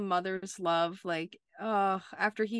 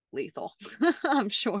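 laughing; 2 semitones higher than usual. Real, not acted. Joking about Prue's wrath.

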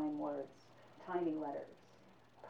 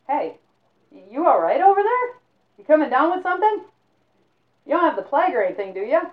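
high, loud worried call; 9 semitones higher, 13 dB louder than usual. Acted. Man calls to a silent roommate, loud and worried. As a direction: call across the dark room, high and loud.

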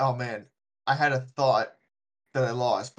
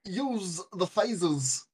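Christopher Walken impression; 5 semitones higher, slower than usual. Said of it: "They all sound like Christopher Walken".

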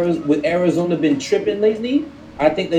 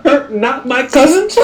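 much higher and slower, in playful disbelief; mock disbelief; 10 semitones higher, 4 dB louder, slower than usual. Real, not acted. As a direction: scoff at the idea, then break into a high laugh. Mock disbelief, then a high laugh.

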